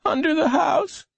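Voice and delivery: put-on high, thin child voice; high-pitched and pained; weak hurt boy. A narrator's reading. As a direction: answer feebly as an injured boy. Injured boy answers policeman.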